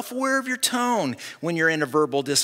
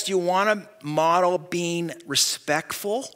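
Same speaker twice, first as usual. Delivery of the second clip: ordinary delivery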